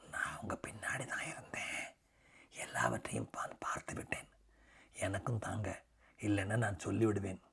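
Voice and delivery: little brother whispering a threat to tell; whispered threat. A narrator's reading. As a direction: whisper the blackmail close to his ear. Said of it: "அவன் மெதுவான குரலில் கேட்டான்".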